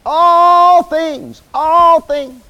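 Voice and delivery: All drawn out high and loud; stress on All. Shown as a demonstration. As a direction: hammer "All" both times, loud and high. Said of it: "Do how many things?".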